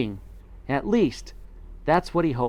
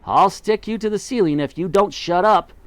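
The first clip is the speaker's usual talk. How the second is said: snapped; 6 semitones higher, 3 dB louder than usual. A narrator's reading. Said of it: "snapped Mugglewump".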